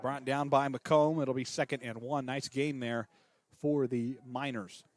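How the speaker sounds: steady radio play-by-play call, tracking each snap; live play-by-play. Acted. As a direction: call the game for listeners at home.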